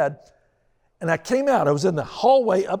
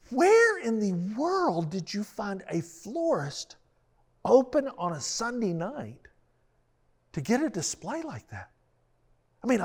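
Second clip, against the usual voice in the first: higher, slow, marveling wife; lightly voiced wife in tearful wonder; a hint of an amazed wife; 5 semitones higher than usual.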